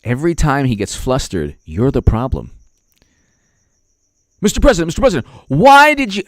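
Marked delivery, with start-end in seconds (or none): 4.4-6.2 high, urgent calling out; imitated press shout. Reporter calling to Mister President, high loud shout. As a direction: shout over the room to get the president's attention.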